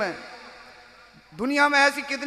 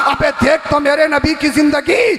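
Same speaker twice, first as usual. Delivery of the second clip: preaching at full force; 7 semitones higher, 7 dB louder than usual. Acted. Preacher reciting Ala Hazrat's verse to the crowd, full-force chanting. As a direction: cry the verse out to the hall.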